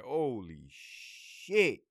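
drawn-out, awed mock reaction; imitated stunned reaction. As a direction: stretch it out slowly, imitating stunned staff. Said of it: "and they're like".

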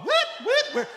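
high excited whooping; very high party cheer; party whoops. Retold or imitated.

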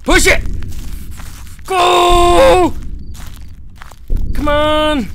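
high, loud urging; urgent yelling. Real, not acted. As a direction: shout to push yourself on. Racing to escape in game.

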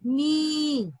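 मी with a long, held ee; long vowel. Shown as a demonstration. Teacher answering her question on मा with the matra, long-held मी. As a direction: draw out the vowel of मी. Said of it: "माँ पे बड़ी की मात्रा लगा दिए थे".

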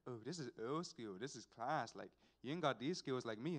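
low, soft, self-satisfied imitation; boastful uncle. Uncle bragging to nephew over his catch, boastful uncle.

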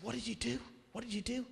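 mock eager, leaning in for the answer; riveted asking. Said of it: "We were, like, riveted".

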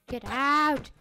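loud repeated command; shout. Real, not acted. Kid yelling at a mob to leave, loud. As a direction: yell to drive it off.

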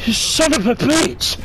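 high furious yell at the screen; angry shout. Real, not acted. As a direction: rage at the enemy. Gamer raging at enemy.

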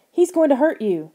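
high, loud warning shout. A narrator's reading. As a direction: call out in alarm for a friend. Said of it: "Lauren shouted".

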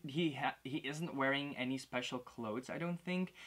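ordinary delivery

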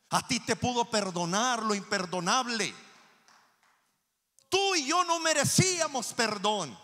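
high, loud, impassioned sermon; full-force preaching. Real, not acted. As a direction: drive the guilt home from the pulpit.

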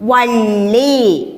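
വള്ളി stretched very slowly; slow drawn-out. Shown as a demonstration. Teacher slowly naming the sign for the children. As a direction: draw out വള്ളി slowly for the class. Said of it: "നമ്മൾ എന്താ പറയുക?".

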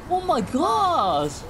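drawn out, slow, high oh my gosh; stunned. Real, not acted. Gamer stunned by the scene, slow and high. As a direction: savor the shock slowly. Reacting to game shock.

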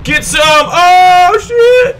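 very high, loud battle yell; excited shout. Acted. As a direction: yell it high over the dogfight. Shouting in game combat.

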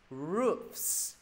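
ROOFS with a true f before the s; clear enunciation. Shown as a demonstration. Teacher correcting students, ROOFS with clear f-s ending. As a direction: let air hiss through the fs in ROOFS. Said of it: "phải đọc rõ".

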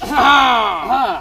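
ordinary talk, nothing marked

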